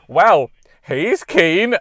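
slow, drawn-out dry amusement; dry remark. Retold or imitated. The instructor to her colleague about the eager learner, dry and slow. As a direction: say it slow and wry, with a laugh. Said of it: "she went".

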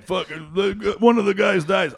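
high mock spoiler shout; spoiler yell. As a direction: blurt the spoiler. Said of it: "a Marvel movie where you're like".

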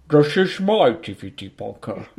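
put-on British film-star voice; mock Cockney-actor voice; Michael Caine. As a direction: do a hammed-up English actor impersonation. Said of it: "Oh, Michael Caine".

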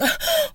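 sharp high gasp in mock shock. Mock gasp at an ex's insult. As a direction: gasp like you've been wounded. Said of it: "And I'd be like".

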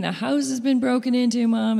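high, loud mock cry of alarm; retold kids' panicked yell. Kids shouting to mom about the break-in, high and loud. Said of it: "the kids start yelling".

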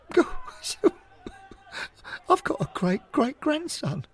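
high, breaking voice choked with happy tears; old man overcome, crying with joy; tearful joy. Acted. Old fan learning his great-great-grandson plays, crying with joy. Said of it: "Skilly's eyes widen and wetten".

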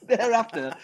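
talking through a laugh; can't quite stop laughing. Real, not acted. Guest still laughing after the Clint story, talking through laughter.

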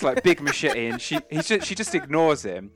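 usual speaking voice

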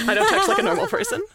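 high, laughing self-mockery; giggling through the line. Real, not acted. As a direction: laugh it out at yourself. Laughing at herself.

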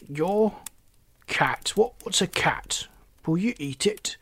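baffled frog, pitch lifting on the questions; puzzled frog. Acted. Frog asks Oliver what a cat is, puzzled. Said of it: "the puzzled frog".

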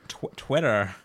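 copied American "Twitter", tentative start; attempted American accent. Co-host copying the West Coast word, attempted American accent. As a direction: stumble into an American-sounding Twitter. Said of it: "say with an American accent".

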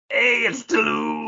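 high, slow, whiny cat voice; introducing the cat Toulouse in his own voice. Pretend. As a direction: speak for the cat as he strolls in. Voicing the cat Toulouse's entrance.

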